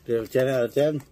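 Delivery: eating while talking; chewing. Real, not acted. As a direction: mouth partly full. Seen 2: Holds cookie, chews between lines, bites it as words end.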